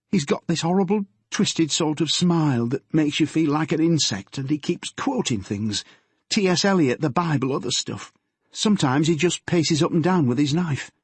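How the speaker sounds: put-on nervous girl confessing to her father; teenage daughter Yvonne.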